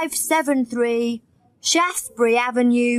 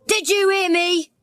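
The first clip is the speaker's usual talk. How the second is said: shouted; 8 semitones higher than usual. A narrator's reading. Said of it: "shouted Tucker".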